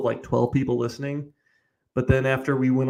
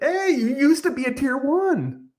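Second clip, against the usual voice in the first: mocking voice; 12 semitones higher than usual. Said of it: "is that what you would tell".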